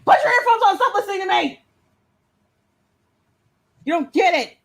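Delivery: shrill exasperated shout at husband; high-pitched yell. Real, not acted.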